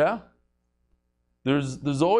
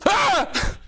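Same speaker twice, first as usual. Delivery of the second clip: high mock shriek of fright; startled yelp; 3 dB louder than usual. Hunter in the joke discovering the moose, high startled yelp. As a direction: yelp as if you just saw the moose. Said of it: "He goes".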